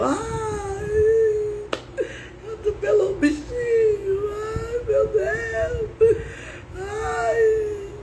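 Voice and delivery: exaggerated high-pitched wailing; mock crying. Imitating herself weeping over a run-over animal, hammed-up high wail. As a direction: wail long, drawn-out vowels in a hammed-up sob. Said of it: "eu chorava até se eu visse um cachorro morto".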